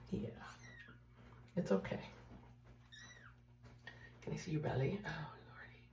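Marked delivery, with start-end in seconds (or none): 5.0-5.8 quick whispered aside. Real, not acted. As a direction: whisper it to yourself. Whispered aside at many fleas.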